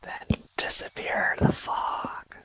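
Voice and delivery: slow whispered retort; whispering back. Acted. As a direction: whisper it back slowly at the fog. Player answers the whispers in whisper.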